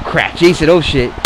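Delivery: ordinary talk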